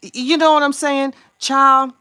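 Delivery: high loud exclaim. Real, not acted. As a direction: shout it out, worked up. Fired-up rant about Kardashians.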